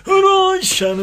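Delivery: loud, high, hammed-up Yorkshire greeting; Yorkshire accent. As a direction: bellow a Yorkshire hello to Sharon. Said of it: "That's a Yorkshire accent".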